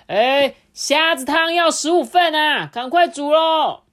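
high, loud, slow calling out; calling order. Acted. Customer calling out a shrimp soup order loudly. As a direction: call the order across.

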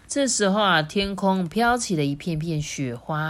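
plain reading voice

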